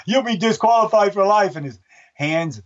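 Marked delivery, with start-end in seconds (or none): 0.1-1.6 dad voice. Retold or imitated. Announcer warns a runner about to moon, in a stern dad voice. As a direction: scold him like a father stopping a kid. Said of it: "it's like the voice I got".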